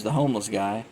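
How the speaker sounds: ordinary talk, nothing marked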